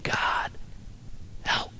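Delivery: faint, voiceless plea with a long gap; barely a whisper. Acted. Dying psalmist crying out to God, faintly whispered. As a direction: whisper it as if too weak to speak. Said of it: "someone who can barely whisper".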